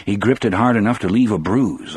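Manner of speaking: usual speaking voice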